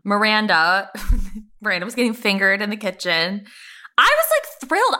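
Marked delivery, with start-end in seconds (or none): 4.0-5.0 thrilled. Real, not acted. Gushing about a sex scene.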